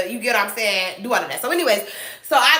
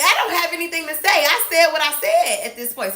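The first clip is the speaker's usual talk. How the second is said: higher-pitched, worked-up comeback; agitated retort; 6 semitones higher than usual. Retold or imitated. Employee answering back to her supervisor from the time clock, agitated. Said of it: "I'm agitated. You hear in my voice".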